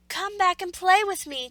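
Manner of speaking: high, loud call after them. A narrator's reading. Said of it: "called the little rabbit".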